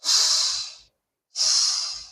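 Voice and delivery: long, soft hushing "sh"; isolated sh consonant demo; drawn-out "sh" sound. Shown as a demonstration. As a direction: hold out a steady sh. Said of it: "the sound".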